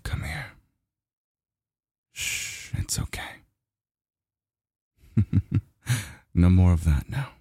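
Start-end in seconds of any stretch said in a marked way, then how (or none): 0.0-3.2 soft shushing whisper; hushing whisper. Acted. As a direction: hush them gently, barely voiced. Soothing a crying lover.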